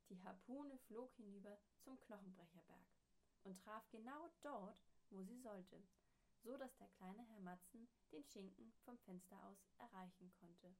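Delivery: ordinary delivery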